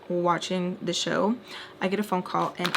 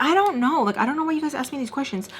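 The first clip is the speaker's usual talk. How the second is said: defensive; 6 semitones higher, faster than usual. Retold or imitated. Defensive reply to upset caller.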